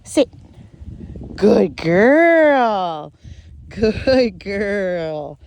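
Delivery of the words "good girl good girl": praising the dog. Real, not acted. Trainer rewarding her dog, drawn-out happy praise. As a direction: praise her like she did great. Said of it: "I'm gonna give her a little pet".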